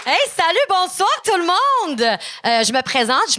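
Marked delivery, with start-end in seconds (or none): 0.0-2.0 high, projected hello to the crowd; big stage greeting. Real, not acted. Comic greeting the whole room, calling out high. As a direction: call out a greeting, stretching the end.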